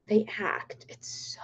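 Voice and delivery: soft, slow unvoiced whisper. Real, not acted. Streamer whispering to chat how weird they act. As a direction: drop to a hush in disbelief.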